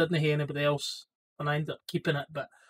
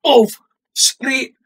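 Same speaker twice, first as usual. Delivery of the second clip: mock crash noises; 3 semitones higher, 10 dB louder, much slower than usual. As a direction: shout out the impact. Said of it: "It's a video of me going".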